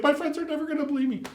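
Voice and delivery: put-on squealing high, fast, giddy kid voice; starstruck teen. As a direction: gush like a teenager meeting his heroes. Teen fanboy meeting his idols.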